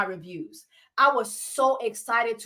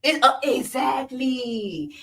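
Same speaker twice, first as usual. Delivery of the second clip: slow, hesitant then smug exactly; pause, then drawl exactly; mocking the hosts, slow hesitant "exactly"; mocking podcast hosts; slower than usual. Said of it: "rename this podcast the Exactly Podcast".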